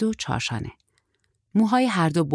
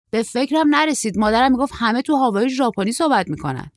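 put-on high, louder boyish excuse; raised young man's character voice; young surfer, sheepish; 6 semitones higher, 5 dB louder than usual. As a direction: play a naive young traveller, pitched up.

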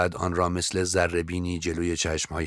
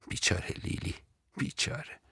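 whispered self-pity; 8 semitones higher, 10 dB quieter than usual. Acted. Lily pities herself.

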